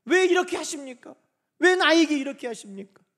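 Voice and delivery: high, loud protest; resentful complaint. Acted. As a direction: cry out a reproach to God. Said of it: "원망은".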